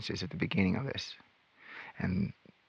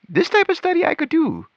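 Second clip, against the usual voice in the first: eager; 4 dB louder than usual. Retold or imitated. Eager about Bible study.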